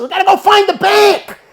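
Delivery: loud, high-pitched urgency; retold desperate insistence; frantic shout. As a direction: shout it like the money is getting away. Friend frantic to find his money.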